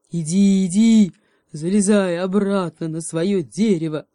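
loud, scolding bear voice. A narrator's reading. Bear chasing the monkey back, loud scolding. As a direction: drive her off with a shout. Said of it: "и приговаривал".

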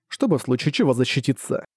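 plain reading voice

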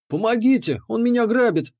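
high-pitched pleading wail; plaintive cry for help. A narrator's reading. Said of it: "жалобно взывал к народу".

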